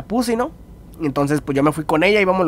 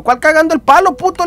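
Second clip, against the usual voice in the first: higher, louder indignant comeback; mock angry retort; 9 semitones higher, 5 dB louder than usual. Teller retorts to his friend, mock indignant and higher. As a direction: snap back indignant at the accusation. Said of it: "Le digo".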